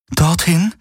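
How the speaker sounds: high, unsure questioning; doubtful. A narrator's reading. Kirai doubts the spot Hawks points to, high and unsure. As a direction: ask as if you can't believe it. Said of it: "fragte sie zweifelnd".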